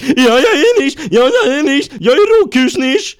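very high, loud ecstatic scream. Acted. Kurt screaming at the homeless men, very high and loud. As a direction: yell it while running in circles. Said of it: "och skrek".

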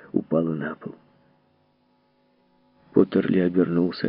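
usual speaking voice

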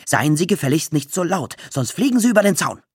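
indignant yell, speeding up; angry shout back. A narrator's reading. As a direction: fire back a threat. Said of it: "wurde böse und schrie auch".

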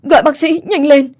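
high, loud panicked call; urgent shout. A narrator's reading. Grandmother shouting for a doctor over the half-drowned boy, panicked yell. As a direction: yell for a doctor at the top of your voice. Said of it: "Bà vội hét lên".

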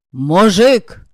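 loud bark of the word. A narrator's reading. Pan Zusek barks at the shy lad, loud. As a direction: bark it at him. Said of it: "рявкнул пан Зусек".

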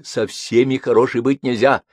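usual speaking voice

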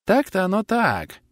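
high, slow sighing agreement; slow, wistful neighbour's voice; sighing concession. A narrator's reading. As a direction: draw it out in a high, rueful voice. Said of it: "вздохнул сосед по столику".

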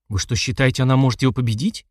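ordinary delivery, nothing marked

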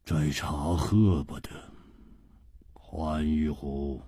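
low, soft, slowing icy order; cold. A narrator's reading. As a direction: order it coldly, without raising the voice. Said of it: "冷冷地说".

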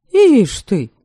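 plain reading voice

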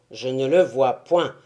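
low, loud stage tyrant. A narrator's reading. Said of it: "ajouta le tyran".

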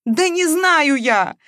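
loud, high, drawn-out tearful protest; sobbing outburst. A narrator's reading. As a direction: wail it in frustration. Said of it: "снова всхлипнула Маша".

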